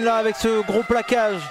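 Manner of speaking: ordinary delivery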